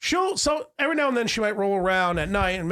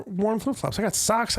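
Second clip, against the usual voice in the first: ordinary talk, nothing marked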